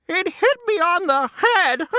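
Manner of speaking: high, fretful hen cluck. Acted. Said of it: "clucked Henny Penny".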